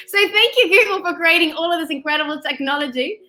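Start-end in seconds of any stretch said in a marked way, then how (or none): none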